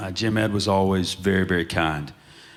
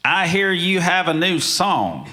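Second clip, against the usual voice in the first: higher, louder, slow mock of a teasing grand lady; teasing older lady; 10 semitones higher, 5 dB louder than usual. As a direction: lean in like a lady who knows your secret. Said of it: "she says".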